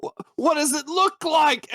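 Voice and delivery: high, exasperated little gnome; gnomish voice. Acted. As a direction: squeak back in irritation. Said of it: "gnomish voice".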